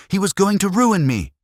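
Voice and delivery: high, loud scream of rage; a full furious scream. A narrator's reading. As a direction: scream it in fury. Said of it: "she screamed".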